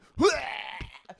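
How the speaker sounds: high, loud imitated heaving cry; drawn-out comic puking noise; mock retching. Dog walker hears a sick figure, mock retching.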